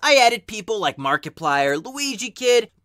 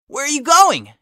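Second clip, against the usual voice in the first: higher and louder, alarmed; worried call; 7 semitones higher, 5 dB louder than usual. Acted. Tails calling after Steve as he leaves, alarmed call. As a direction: call after him in a panic.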